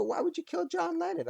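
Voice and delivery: high incredulous question; mock fan demanding why; mock outraged people. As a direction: squeak it in disbelief. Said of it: "people always go".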